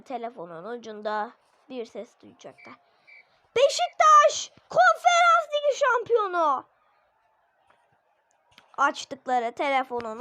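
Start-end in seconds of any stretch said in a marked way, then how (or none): 3.6-6.6 çok ince, yüksek ve gür sesle anons et; şampiyonluk anonsu. Pretend. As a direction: telefonda çıkan tiz bir ses gibi ilan et. Imitating phone voice announcement. (telefon açılınca duyulan tiz anons sesi)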